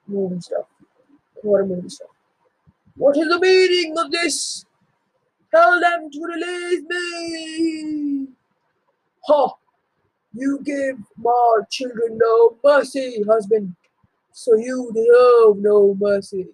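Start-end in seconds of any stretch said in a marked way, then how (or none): 3.0-8.3 a trapped tyrant crying out in fury; outraged captive king. Acted. Captured father demanding release from his sons, outraged. 9.3-16.5 slow, loud scornful retort; scornful taunt. Acted. Wife scorning her captured husband, mocking and stern.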